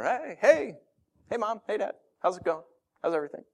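put-on high, breezy teenager greeting; lightly voiced carefree teen; cheery teen daughter. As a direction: breeze in as if nothing happened.

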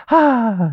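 high, drawn-out adoring sigh; heart-melted little "ahh"; melting "aww". Retold or imitated. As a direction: coo as if the baby just smiled at you. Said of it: "your heart just melts".

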